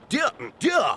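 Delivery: high flustered stammer; flustered squeal. Acted. Flustered stalling before insult.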